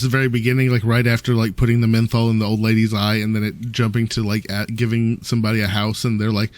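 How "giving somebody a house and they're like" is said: normal talking voice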